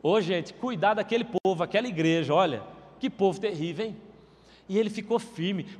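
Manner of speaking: ordinary talk, nothing marked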